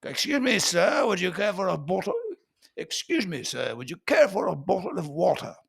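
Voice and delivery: upper-class British, no R, crisp T; fancy British waiter-style politeness, every T sounded; posh British accent. Acted. Posh Brit offering sir water, British accent. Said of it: "but they say the T".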